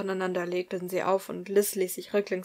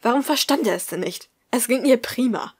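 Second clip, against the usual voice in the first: higher, louder exasperation; indignant protest; 3 semitones higher, 5 dB louder than usual. A narrator's reading. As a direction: insist on it, still irritated. Irritated inner protest after outburst.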